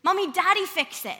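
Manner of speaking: put-on high, loud toddler request; mock small-child plea; little boy. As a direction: imitate a toddler asking mom for help. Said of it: "So he asks me, he says".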